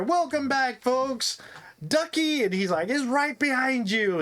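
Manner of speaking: high, bright emcee call; hammed-up announcer; game show host. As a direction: welcome the crowd like a cheesy host. GM voices announcer Larry.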